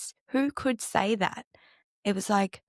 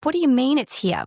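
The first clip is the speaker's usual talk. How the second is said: louder and higher, pressing the question; demanding it again in disbelief; incredulous repeat; 5 semitones higher, 5 dB louder than usual. Retold or imitated. Retelling pushing the psychic's friend for an answer, louder and disbelieving. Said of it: "And I was like".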